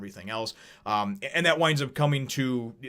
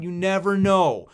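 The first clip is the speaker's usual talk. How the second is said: slow, emphatic "You never know", pitched up; emphatic slow line; 7 semitones higher, 6 dB louder, slower than usual. Real, not acted. Telling viewers the karma moral, slow and emphatic.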